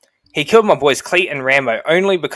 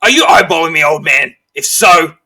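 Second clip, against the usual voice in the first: loud, aggressive sergeant's challenge; raised, confrontational yell; angry bullying; 3 semitones higher, 7 dB louder than usual. Acted. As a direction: bawl him out like a drill sergeant. Sergeant berates old servant.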